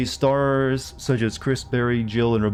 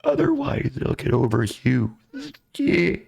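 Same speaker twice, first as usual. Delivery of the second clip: creepy monster voice; slower than usual. Shown as a demonstration. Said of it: "one monster voice".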